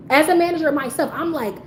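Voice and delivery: normal talking voice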